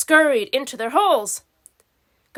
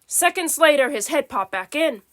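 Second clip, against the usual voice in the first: usual speaking voice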